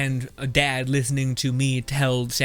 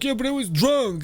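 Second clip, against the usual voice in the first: mock drunk driver; 9 semitones higher than usual. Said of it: "you're like".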